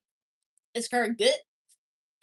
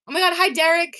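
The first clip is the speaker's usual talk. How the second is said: louder, higher happy hi; excited greeting; 6 semitones higher, 8 dB louder, faster than usual. Real, not acted. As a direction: greet Derek brightly. Greeting a chatter.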